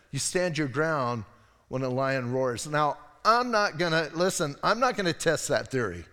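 normal talking voice

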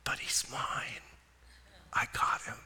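soft unvoiced whisper. Jesus whispering to the Father about Pat, in a tender whisper. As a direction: breathe it close into his ear. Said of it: "there to whisper in his ear".

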